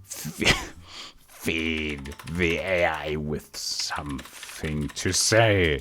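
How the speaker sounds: soft, slow words through a laugh; laughing murmur. Real, not acted. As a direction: giggle while typing. Laughing while typing.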